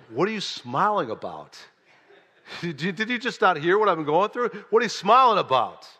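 loud, high, exasperated retelling; indignant outburst. Retold or imitated. Retold outburst at smiling counselor.

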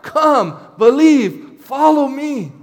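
loud, high, passionate cry. Shown as a demonstration. Preacher showing Jesus begging people to follow, loud crying out. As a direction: plead it out at full voice, begging them to come. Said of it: "No, he's crying out".

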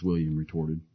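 usual speaking voice

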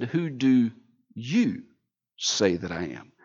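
low, soft, pointed; quiet probing question. Acted. Jesus asking his disciples, low and soft. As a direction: lean in and ask softly.

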